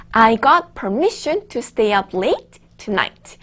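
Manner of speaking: slow, clear read for repeating; slow model reading. Shown as a demonstration. Teacher reading a sentence slowly for students to repeat. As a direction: say it slowly so the class can follow. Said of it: "정확하게 따라해주시면".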